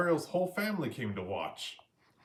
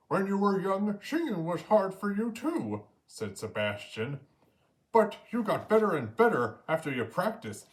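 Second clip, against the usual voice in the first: higher, louder crab voice with a Caribbean lilt; Sebastian the crab; 7 semitones higher, 4 dB louder than usual. A narrator's reading. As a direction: encourage her like a proud mentor. Sebastian encourages Ariel.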